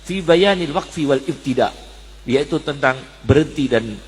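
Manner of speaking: ordinary talk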